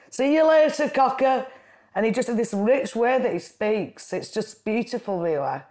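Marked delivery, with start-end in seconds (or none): 0.1-1.5 higher, louder Lancashire goodbye; Lancashire farewell. Retold or imitated. Uncle calling goodbye from the coffin in a higher Lancashire voice. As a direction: sing it out cheerily in broad Lancashire. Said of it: "all I could hear him say is".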